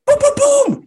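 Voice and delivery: high loud boom boom boom; news sting vocalised. Pretend. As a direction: belt it out like a jingle. News sting sound effect.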